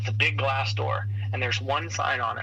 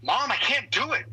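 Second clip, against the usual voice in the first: put-on high, whiny kid's plea; frustrated little boy; 9 semitones higher than usual. Son stuck on his jacket zipper complains to mom, high whiny kid voice. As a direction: whine it like a small boy who gives up. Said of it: "And he was like".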